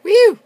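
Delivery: high, loud cheer; excited whoop. Real, not acted. Gamer cheering the explosion, excited whoop. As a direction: give one short whoop. Blew it up in game.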